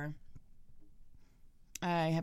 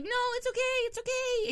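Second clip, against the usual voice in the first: high, soothing reassurance; calm the child down, pitch up; mock soothing. Parents reassuring their scared son, high and soothing.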